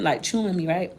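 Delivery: ordinary talk, nothing marked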